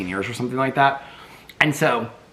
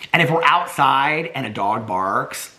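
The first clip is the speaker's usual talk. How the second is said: usual speaking voice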